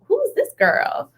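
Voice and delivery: high, drawn-out puzzled quote; mock bafflement. Quotes own baffled reaction.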